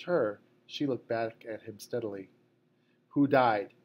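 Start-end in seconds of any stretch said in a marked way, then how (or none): 3.1-3.7 louder, a harsh demand; harsh question. A narrator's reading. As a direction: bark the question at the room. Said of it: "he asked harshly".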